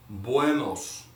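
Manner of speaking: "Buenos" drawn out slowly; slow model. Shown as a demonstration. Teacher slowly models "Buenos" for repetition. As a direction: stretch "Buenos" gently for learners. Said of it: "let's go slowly on this one".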